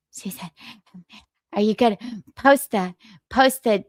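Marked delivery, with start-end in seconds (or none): 0.1-0.5 whispered name; whispering. Retold or imitated. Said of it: "She said".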